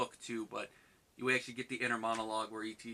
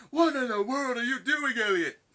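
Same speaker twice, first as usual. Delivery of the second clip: high, loud outraged shout; a teacher catching a student mid-kiss, yelling; shocked teacher; 13 semitones higher, 11 dB louder than usual. Retold or imitated. Teacher yelling at Elliot in the lab, shocked and loud. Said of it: "the teacher's like".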